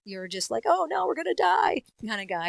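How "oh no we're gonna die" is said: lightly voiced scared guy; a hint of panic; panicky coward. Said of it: "whether you're an admiral or".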